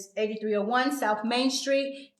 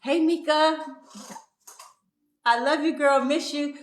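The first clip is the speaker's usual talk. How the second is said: excited shout-out; 6 semitones higher, 5 dB louder than usual. Real, not acted. Greeting a viewer by name.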